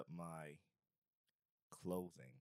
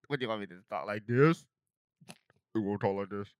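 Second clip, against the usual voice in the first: 1 put-on voice; 11 dB louder than usual. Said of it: "now you sound like Sierra".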